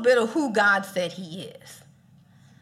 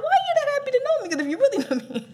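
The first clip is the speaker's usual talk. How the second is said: fast, higher, amazed disbelief; a rapid, higher, baffled question; incredulous quote; 10 semitones higher, much faster than usual. Retold or imitated. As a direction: rattle it off in amused disbelief. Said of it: "you're like".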